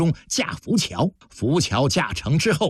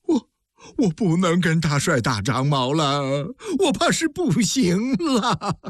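tearful wailing; 4 semitones higher than usual. A narrator's reading. Wounded general weeping to his commander, tearful wailing. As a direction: wail it weakly, stretching the last words. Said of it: "痛哭流涕地说".

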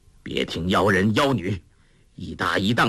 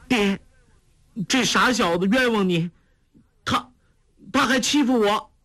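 put-on much higher, girlish pouting complaint; daughter's complaint; 12 semitones higher than usual. A narrator's reading. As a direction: whine to daddy like the daughter. Daughter complaining in father's arms. (少女撒娇告状)

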